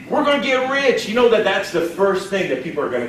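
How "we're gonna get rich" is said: high-pitched hammed-up excitement; mock elation. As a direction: squeal it like an overexcited recruiter. Repeats the over-eager seller's cry.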